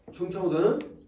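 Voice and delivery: ordinary delivery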